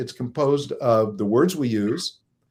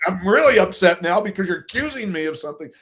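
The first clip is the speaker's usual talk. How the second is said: higher, louder, laughing through the protest; laughing mock upset; 6 semitones higher, 6 dB louder than usual. Partner keeps escalating the argument, laughing mock upset. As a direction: play up the hurt, half-joking. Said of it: "now I'm yelling".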